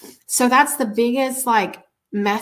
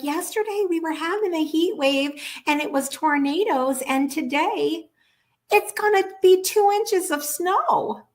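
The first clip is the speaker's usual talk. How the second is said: put-on higher old woman's voice, rising to amazed on the snow; old lady voice; 8 semitones higher than usual. Old lady tells the vlogger about the weather, higher and amazed. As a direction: imitate the neighbour marvelling at crazy weather. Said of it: "She's like".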